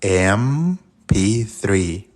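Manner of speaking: very slow M P three to hear the first sound; slow demo again. Shown as a demonstration. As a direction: draw out "M P three" slowly. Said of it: "¿Escuchaste?". ("M P three" muy lento, marcando el sonido inicial)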